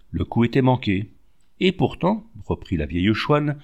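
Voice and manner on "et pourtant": higher-pitched, with growing verve; mildly animated. A narrator's reading. Old chouanne resuming her tale, mildly animated. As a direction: pick the tale back up with some spirit. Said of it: "animée de plus en plus".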